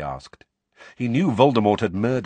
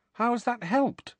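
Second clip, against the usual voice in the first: high, slow question; puzzled boy; slower than usual. A narrator's reading. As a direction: ask slowly in a baffled high voice. Harry asks, baffled.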